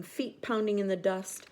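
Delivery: plain reading voice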